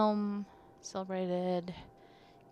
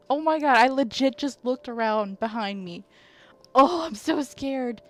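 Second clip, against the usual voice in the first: louder, shaken, slowing down; scared; 3 semitones higher, 8 dB louder than usual. Real, not acted. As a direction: still catching breath from fear.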